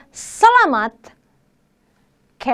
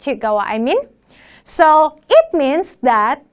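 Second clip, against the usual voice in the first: ordinary delivery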